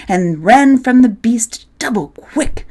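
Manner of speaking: plain reading voice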